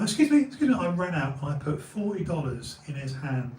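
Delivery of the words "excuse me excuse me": high, loud call for attention; retold calling out. Man runs out after the bag's finder, retold calling out. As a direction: call after someone, pitch jumping up.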